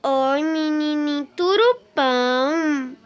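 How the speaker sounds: put-on slow, high cooing baby talk; drawn-out sing-song cooing to a cute in-game pet. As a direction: coo at a cute little creature.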